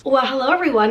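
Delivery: ordinary talk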